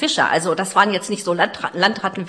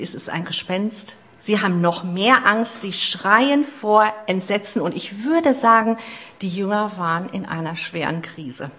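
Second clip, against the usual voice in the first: normal talking voice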